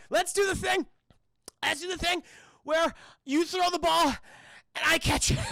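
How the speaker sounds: high, loud, strained huddle voice; hammed-up player voice. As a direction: push it up high like an overeager quarterback. Acting Brad in the huddle.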